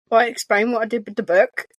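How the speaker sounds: normal talking voice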